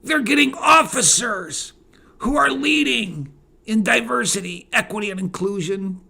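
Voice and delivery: high-pitched mock alarm; mock quaking fear. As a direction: pretend to be generals quaking at the news. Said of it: "quaking in their boots saying".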